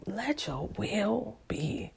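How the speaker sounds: slow, breathless hush to the Lord; slow whispered prayer. Shown as a demonstration. Praying alone, surrendering, slow whisper. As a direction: let it drift out in a whisper. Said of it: "prayer repeated in whisper".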